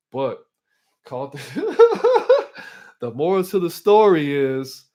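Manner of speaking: cracking up mid-sentence; laughter breaking into the words; real laughing. Real, not acted. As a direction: burst out laughing, then push on loudly.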